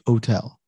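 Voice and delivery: "hotel" with the H dropped, "oh-TEL"; silent H. Retold or imitated. Host giving an example of a Spanish speaker's English, "hotel" with silent H. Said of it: "H's are silent. So they might say hotel".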